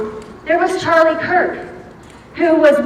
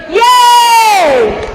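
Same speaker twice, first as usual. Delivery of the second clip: much higher, loud shouted "Yay!"; cheering yay; 9 semitones higher, 5 dB louder than usual. Shown as a demonstration. Leading the audience in the call, loud cheer. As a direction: lead the crowd's cheer. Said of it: "Can we try that?".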